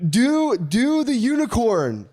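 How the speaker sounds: put-on high, slow little kid voice. Dad imitating his kids asking for the unicorn face. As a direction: demand it like a small child. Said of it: "That's good".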